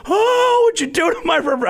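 shocked wail. Retold or imitated. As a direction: squeal it like your prize was wrecked. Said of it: "I about cried".

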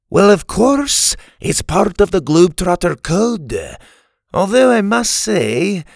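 put-on high-pitched old Scottish character voice; crotchety Scotsman, matter-of-fact. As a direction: explain it like a grumpy old Scot. Story's crotchety old Scottish man.

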